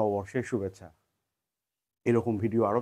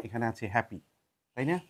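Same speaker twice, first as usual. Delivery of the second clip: ordinary talk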